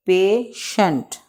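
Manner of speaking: Patient slowly as pay, pause, shent; two separate beats on patient; broken into syllables. Shown as a demonstration. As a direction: leave a gap inside patient. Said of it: "इसको pronunciate कर लेते हैं".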